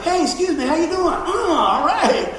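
high, mocking sing-song greeting; hammed-up snide small talk; mock sarcastic exchange. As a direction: play the fake-polite brush-off. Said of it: "and it's like".

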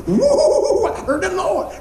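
high, loud whoop breaking into laughter; giddy excited whoop. Retold or imitated. Preacher retelling his giddy shout in the hall. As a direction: crow with delight like you just heard from God. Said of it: "They think I'm an idiot 'cause I'm going".

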